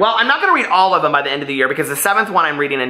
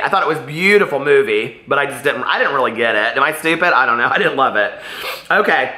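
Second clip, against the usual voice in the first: ordinary talk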